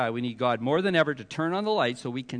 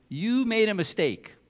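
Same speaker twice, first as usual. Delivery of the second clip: galling accusation; 7 semitones higher, 4 dB louder than usual. Retold or imitated. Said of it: "he's got the gall to say".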